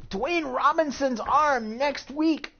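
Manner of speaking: ringside commentary wrap-up; wrestling announcer. Acted. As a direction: recap the brawl like a TV commentator. Announcer wraps up segment.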